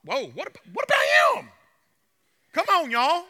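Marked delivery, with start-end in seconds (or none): 0.3-1.5 high shouted protest; shouted Peter. Acted. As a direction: yell it out, much higher and louder. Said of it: "But, whoa".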